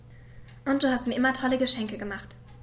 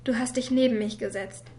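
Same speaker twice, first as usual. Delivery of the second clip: ordinary talk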